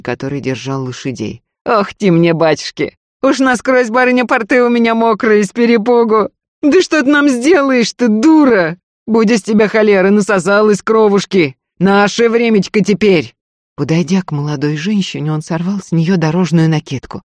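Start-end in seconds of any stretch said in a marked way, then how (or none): 1.6-13.3 high, loud mocking lad; jeering young robber. A narrator's reading. Pockmarked lad holding horses mocks the lady, jeering. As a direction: taunt her with feigned fright in a raised voice. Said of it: "Не мог успокоиться рябой, встрёпанный парень".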